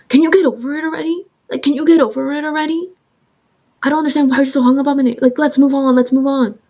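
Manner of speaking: high, fast, exasperated ex; mock annoyed ex; impatient boyfriend. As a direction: push her to drop it. Ex impatiently dismissing her.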